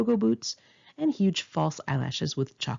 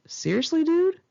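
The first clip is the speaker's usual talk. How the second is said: a startled, rising seriously dude; incredulous; 11 semitones higher, 4 dB louder than usual. Retold or imitated. To whoever told her about the crack, high disbelief. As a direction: pitch climbing high in disbelief, peaking on dude. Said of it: "I was like".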